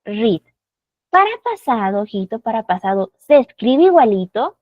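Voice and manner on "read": "read" with a long ee vowel; present-tense pronunciation. Shown as a demonstration. Teacher showing students the present form, long ee pronunciation. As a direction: say "read" like "reed". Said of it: "En tiempo presente es".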